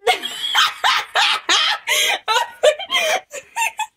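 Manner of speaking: long, high, loud cackling fit; helpless squealing laughter; hysterical laughter. Real, not acted. Vlogger losing it over the friend on camera, hysterical laughter.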